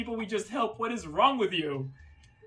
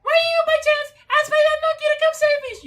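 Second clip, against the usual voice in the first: very high, loud squeal; screaming princess voice; 10 dB louder than usual. Acted. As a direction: shriek it like a spoiled princess. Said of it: "she screams everything as well".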